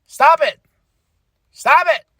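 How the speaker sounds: high-pitched exasperated rant; frustrated. Retold or imitated. Fan fed up with R-Truth's name, frustrated. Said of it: "It's frustrating. I'm like".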